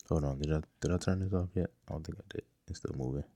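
a quick off-mic aside to himself; muttered aside. Real, not acted. Host pausing his pitch to check the beat, muttering off-mic.

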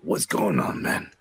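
low, casual tough-guy greeting; lightly voiced badass saying hi; imitating the villain's voice. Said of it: "he's just gonna be like".

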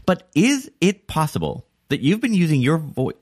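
IS and POSSIBLE punched high, oddly emphatic intro; over-hit "is" and "possible" like a misjudged ad read; stress on wrong words. Shown as a demonstration. Host botching podcast intro on purpose, wrong words stressed. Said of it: "to emphasize the wrong words".

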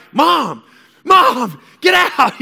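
put-on very high, loud teenage yell; acted-out exasperated shout at Mom. Son in the shower orders mom out, yelling. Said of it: "this is yelling".

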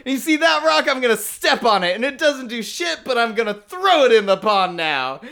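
high, worked-up, ranting; hammed-up angry hero; mock angry rant. Just getting angry.

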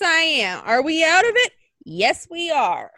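normal talking voice

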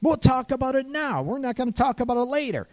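mock partner refusing to wait; insistent partner.

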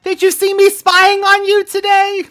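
very high-pitched cartoon critter voice; Luki. Host imitates the spying cartoon character, squeaky high voice. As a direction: squeak it out in a steady, high little voice. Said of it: "And then also Luki".

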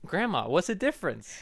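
ordinary talk, nothing marked

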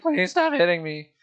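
lightly mimicked whiny kid; a hint of mock begging; pleading bullied kid.

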